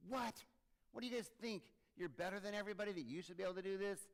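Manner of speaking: high, loud indignant outburst; indignant disciples. Acted. The ten disciples rounding on James and John, indignant shout. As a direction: protest it high and loud, offended. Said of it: "they became indignant with James and John".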